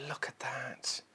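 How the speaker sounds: whispered in awe; awed whisper. Real, not acted. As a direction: breathe it in wonder. Awed by the fabric.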